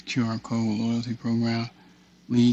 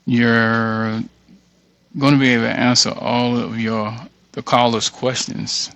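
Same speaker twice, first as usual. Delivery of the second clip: loud, fast sales pitch; energetic promo read to the viewer; 8 dB louder than usual. Acted. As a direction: sell it like a radio ad, punchy and loud.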